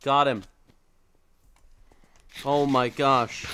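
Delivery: loud victory call, then drawn out; loud, relieved exclamation after a win; loud relief. Real, not acted. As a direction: let out the tension loudly. Finally kills opponent in game.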